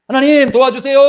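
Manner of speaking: high, loud desperate plea; crying out to God. Shown as a demonstration. Believer crying out to God in prayer, high and loud. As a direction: cry out for help with everything. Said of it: "하나님께 막".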